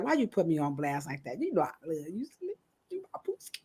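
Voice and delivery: low, hushed mock complaint; retold soft protest. Friend put on blast, protesting softly. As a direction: murmur it softly, imitating a friend. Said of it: "She like".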